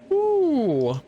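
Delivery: high, drawn-out whistle; long falling whistle. Acted. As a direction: whistle high, then let it slide down. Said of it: "He whistles".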